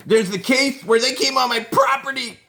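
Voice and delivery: high, loud mock shriek; mock hysterical shout. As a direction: ham up a frantic outburst. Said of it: "she starts going crazy".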